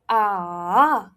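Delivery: long V-shaped AH; third tone. Shown as a demonstration. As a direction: let AH sag and lift it back up. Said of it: "it drops and then it rises".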